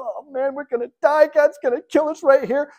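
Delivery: hammed-up terror of a man on the ground; mock panic. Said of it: "while the husband's freaking out".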